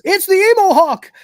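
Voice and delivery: high loud alarmed shout. Retold or imitated. Crichton warns crew of the hawk, loud alarmed shout. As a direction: yell the warning in alarm. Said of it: "Crichton shouts".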